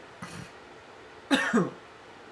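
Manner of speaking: hard choking cough; coughing up water. Real, not acted. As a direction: cough after water goes down wrong. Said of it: "That thing went down the wrong pipe".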